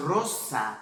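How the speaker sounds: "roça" with a rolled r at the front of the mouth; rolled Spanish r. Shown as a demonstration. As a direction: trill the r of "roça" with the tongue. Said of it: "Já quando eu falo".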